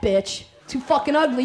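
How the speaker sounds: ordinary delivery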